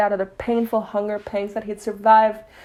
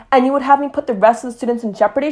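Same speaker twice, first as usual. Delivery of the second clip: snapping; 4 semitones higher, 6 dB louder, faster than usual. A narrator's reading. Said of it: "she snapped".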